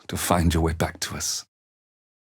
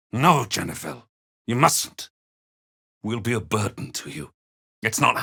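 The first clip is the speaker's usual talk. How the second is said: urgent plea; 3 semitones higher than usual. Acted. Parent begging a daughter not to do it, urgent plea. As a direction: plead to stop them.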